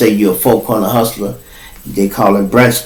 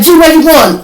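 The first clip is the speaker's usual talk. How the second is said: calling out; 5 dB louder than usual. Retold or imitated. Inmates calling after fleeing narrator.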